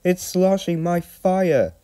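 high-pitched, alarmed little engine; acted high-voiced distress; hissing engine. A narrator's reading. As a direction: squeal it in dismay at the cold water. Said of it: "he hissed".

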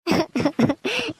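short choked sobs before speaking; tearful sob. Acted. Maid moved by her employer's gift, sobbing. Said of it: "ಅಳ್ಬೇಡ".

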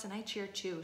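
ordinary delivery, nothing marked

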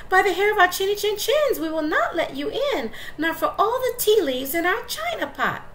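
high, loud and defiant; defiant little wolves. Acted. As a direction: chant it up high with a laugh. Wolves refuse the pig.

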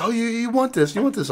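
high-pitched mock salesman; eager high hawker imitation; pushy salesman. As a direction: push the goods in a high voice. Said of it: "I let a pushy salesman".

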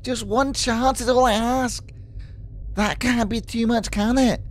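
high pleading Scottish ex; put-on Scottish pleading; Scottish accent. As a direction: beg for a chance in mock Scottish. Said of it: "He's from a Scottish accent will do".

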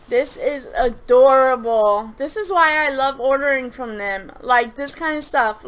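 loud, emphatic adoration; gushing delight. Real, not acted. Vlogger opening a favorite shop's slime package, gushing with delight. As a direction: gush loudly, leaning on the key words.

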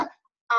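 啊 rising from mid to high; second tone. Shown as a demonstration. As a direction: lift 啊 upward like a car climbing. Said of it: "声音是向上扬的".

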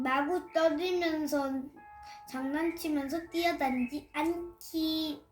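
plain reading voice

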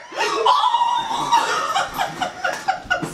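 shrill squealing laugh; high scared giggle; high nervous laugh. Real, not acted. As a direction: laugh from fright. Scared reaction.

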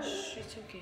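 soft shushing comfort; gently calm her down; soothing hush. Real, not acted. Mom to her hurt daughter, soft shush.

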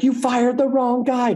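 high, drawn-out mock cry; mock frantic call. Crew calling out the firing mistake, high mock-frantic call. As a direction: pitch it way up and stretch it out.

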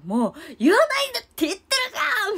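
high, loud, fast indignant yell; mock angry outburst. Rinko snapping at teasing fans, high loud angry. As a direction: snap it out like a furious idol. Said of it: "りんこちゃんガチギレして".

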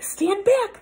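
ordinary delivery

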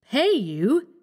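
high-pitched, disbelieving question; aghast echo of the demand; incredulous cry. A narrator's reading. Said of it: "he cried".

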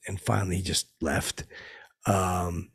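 ordinary talk

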